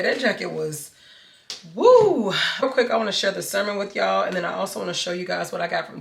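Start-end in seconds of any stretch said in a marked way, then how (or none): 1.7-2.3 high, loud exclaimed woo; hot woo. Real, not acted. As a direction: blow out a big woo. Reacting to being hot.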